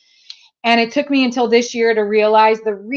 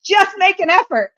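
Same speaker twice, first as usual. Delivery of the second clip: mock screaming mom; 3 semitones higher, 5 dB louder than usual. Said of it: "most competitive mom out there".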